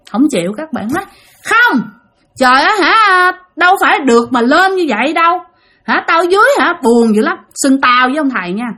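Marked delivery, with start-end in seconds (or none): 1.5-7.4 high, insistent refusal; vong từ chối. Acted. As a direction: protest as the stubborn spirit. (giọng vong cao, khăng khăng từ chối)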